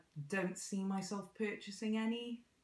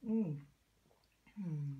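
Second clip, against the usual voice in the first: slurping hot coffee through a sore lip; sipping hot coffee; 2 semitones lower than usual. Real, not acted. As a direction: sip carefully while humming. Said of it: "Sorry for slurping".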